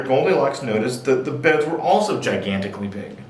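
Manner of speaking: ordinary delivery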